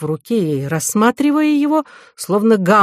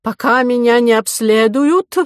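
high, raised voice over a din; indignant, over noise; 6 semitones higher, 5 dB louder than usual. A narrator's reading. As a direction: complain loudly above the growing noise. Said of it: "перекрывая всё растущий шум". (возмущённо, перекрикивая шум)

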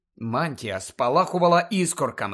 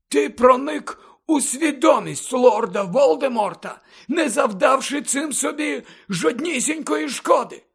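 much higher than narration, fervent mentor; Dumbledore's impassioned lecture; 10 semitones higher than usual. Acted. Headmaster persuades pupil of his pure heart, impassioned and earnest. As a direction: press each point earnestly, with feeling.

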